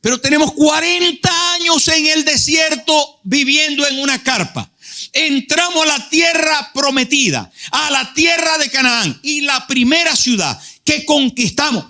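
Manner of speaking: high, fervent preacher's cry; impassioned preaching. Acted. Preacher to the congregation, crying out in fervour.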